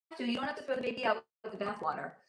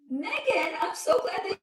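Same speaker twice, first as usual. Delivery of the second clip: excited greeting; 11 semitones higher, 5 dB louder than usual. Real, not acted. Greeting a viewer on stream.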